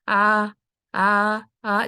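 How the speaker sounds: loud plain "آ" from a glottal stop; separate, forceful "آ" sounds in place of ain; hamza replacing ain. Shown as a demonstration. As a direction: demonstrate the beginner's "آ" with no throat squeeze. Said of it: "وہ اکثر حمزہ کی آواز نکالتے ہیں".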